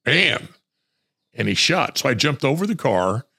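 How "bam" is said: sharp higher bam; imitated gunshot. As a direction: mimic the shot. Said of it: "he went".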